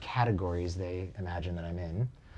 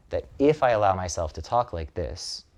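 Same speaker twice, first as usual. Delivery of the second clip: ordinary talk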